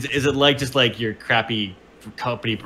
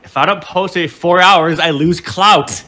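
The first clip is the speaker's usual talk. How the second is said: louder, higher, drawn-out mock distress; acted freak-out; 4 semitones higher, 6 dB louder, slower than usual. Influencer fretting over losing clout, hammed-up freak-out. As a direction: wail it in character, stretching the words.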